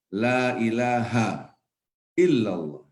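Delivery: very slow, drawn-out proclamation; solemn recitation. Shown as a demonstration. Lecturer setting out the creed for his listeners, slow recitation. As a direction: stretch each phrase out with a falling close.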